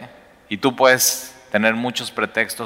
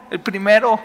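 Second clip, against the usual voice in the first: voz mucho más aguda, como quien responde lo obvio; respuesta obvia; 11 semitones higher, 3 dB louder than usual. Retold or imitated. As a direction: suelta la respuesta con seguridad burlona. El público responde seguro. (el público responde agudo y confiado)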